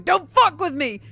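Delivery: high, loud imitation of threatening swearing; mock threat. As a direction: imitate someone aggressively threatening a family. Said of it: "threatening them".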